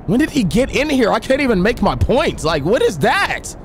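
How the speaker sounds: startled. Real, not acted. Startled by a noise while recording.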